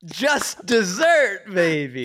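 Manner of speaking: triumphant. Real, not acted. Listener celebrating the thief's capture, triumphant. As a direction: savour the payback, stretching each word.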